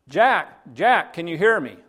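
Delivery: high, carrying holler; calling out. Acted. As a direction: call out to someone far below. Voice calls down to Jack.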